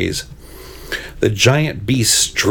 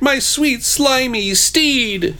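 high, drawn-out mock-tragic wail; hammed-up grief for a lost steed; anguished cry; 14 semitones higher, much slower than usual. A narrator's reading. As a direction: lament grandly, stretching the last word. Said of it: "cried the Red Knight".

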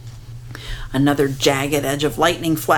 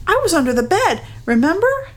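put-on child's voice; 12 semitones higher, faster than usual. A narrator's reading. Child protests in read story.

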